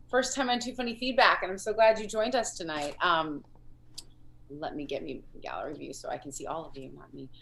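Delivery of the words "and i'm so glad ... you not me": ordinary talk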